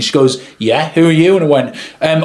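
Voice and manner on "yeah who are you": higher, curt imitation of a colleague; mock passive-aggressive questioning, pitched up. Photographer greets the unknown videographer, imitated passive-aggressive tone. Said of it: "very passive aggressive in her tone".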